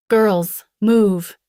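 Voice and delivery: loud urgent order; shouted. A narrator's reading. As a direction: shout them into moving. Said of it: "he shouted".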